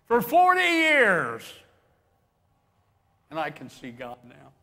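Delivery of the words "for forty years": loud, high, drawn-out exclamation; emphatic exclamation. Real, not acted. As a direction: belt it out slowly in disbelief. Preacher exclaims absurd length of journey.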